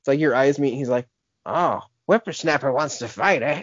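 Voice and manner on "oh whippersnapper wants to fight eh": lightly voiced crusty old man; a hint of a creaky old geezer. Speaker imitates old man challenging a kid, lightly voiced.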